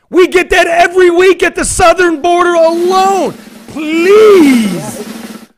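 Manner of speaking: high, loud outburst, drawing out the final please; loud, high-pitched ranting climax; exasperated shout. Real, not acted. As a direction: shout it in disbelief at the top of your range. Exasperated rant over border numbers.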